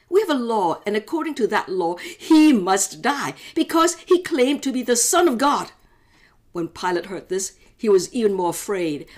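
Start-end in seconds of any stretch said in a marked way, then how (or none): 0.1-5.7 louder, higher insistence; insisting leaders. Acted. Leaders to Pilate, a loud insistent demand. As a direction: press the point hard, raising the voice.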